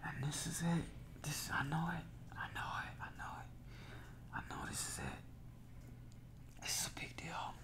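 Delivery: soft, hushed and overwhelmed; awed whisper. Real, not acted. Telling partner this is it, a hushed whisper. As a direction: breathe it to yourself. Emotional realisation on the call.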